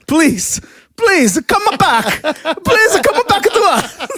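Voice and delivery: high, pleading fake Spanish accent; mock Spanish accent. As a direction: beg in a hammed-up Spanish accent. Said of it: "That, that's my Spanish accent".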